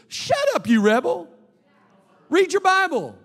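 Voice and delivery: high, loud preacher's reprimand; scolding rebuke. Acted. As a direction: scold a rebel sharply from the pulpit. Mock scolding of a tattoo defender.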